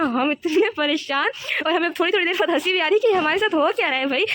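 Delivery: giggling through the memory of the panic; laughing while telling. Real, not acted. Telling viewers about the panic, laughing through it. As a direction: laughs breaking into the words. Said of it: "खैर अभी हंसी तो मुझे भी आ रही है".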